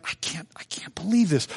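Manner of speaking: whispered disbelief; a hushed I-can't-believe-it; stunned whisper. Retold or imitated. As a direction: breathe it out, shocked. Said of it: "And Nicodemus is like".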